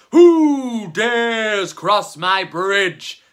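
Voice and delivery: slow, loud, booming troll voice. A narrator's reading. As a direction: boom it out like a huge troll guarding his bridge. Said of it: "boomed the troll".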